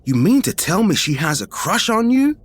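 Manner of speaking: incredulous, half thrilled; mock gleeful amazement; giddy disbelief. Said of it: "a look on their face of disbelief and somehow giddy".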